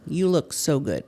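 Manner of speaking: normal talking voice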